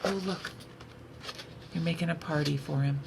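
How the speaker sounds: usual speaking voice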